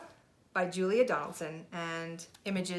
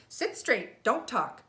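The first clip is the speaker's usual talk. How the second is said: strict teacher; 5 semitones higher, 5 dB louder, slower than usual. Acted. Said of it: "telling the class".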